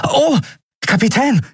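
put-on very high, bright boyish voice; a delighted boy calling out; young Miko. A narrator's reading. Boy Miko greets captain. (sehr hohe Jungenstimme, erfreut)

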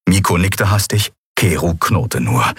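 usual speaking voice

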